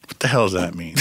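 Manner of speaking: ordinary talk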